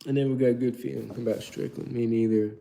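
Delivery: ordinary talk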